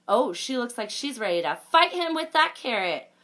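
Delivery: ordinary delivery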